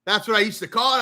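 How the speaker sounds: ordinary talk, nothing marked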